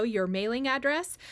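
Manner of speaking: usual speaking voice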